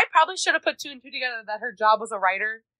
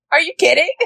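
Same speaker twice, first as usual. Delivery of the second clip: higher, louder mock disbelief; incredulous; 5 semitones higher, 5 dB louder than usual. Reader reacting to Sawyer, incredulous. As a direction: exclaim it in amused disbelief. Reacting aloud to the book's line.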